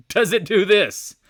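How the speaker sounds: high, loud, drawn-out question; incredulous yell, word by word; emphatic shout. Shown as a demonstration. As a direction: belt out every word slowly. Repeats question, laughing then shouting.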